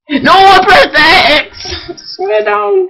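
high, loud cry of frustration; anguished shout. Real, not acted. Gamer yelling at his own mistake, loud anguished shout. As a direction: yell it like you just blew the level. Fails level, cries out.

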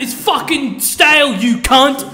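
high, slow mock chef tirade; Gordon Ramsay rant. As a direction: yell it like a TV chef. Gordon Ramsay swearing impression.

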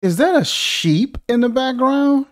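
high-pitched, baffled exclamations; incredulous outcry. Real, not acted. Critic reacting to the man's home, incredulous. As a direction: cry out in rising disbelief.

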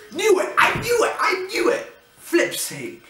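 high, excited, vindicated; excited I-knew-it. Real, not acted. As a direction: crow that you were right. Predicted the hang-up.

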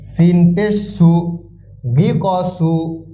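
slow, measured syllable-by-syllable chant; spelling drill recitation. Shown as a demonstration. Teacher modelling Quran spelling for learners, drawn-out drill chant. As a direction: draw out each syllable on a level pitch.

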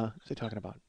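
usual speaking voice

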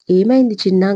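ordinary delivery

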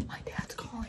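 near-whisper; hushed aside. Real, not acted. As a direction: drop to a whispered heads-up. Hushed aside as phone rings.

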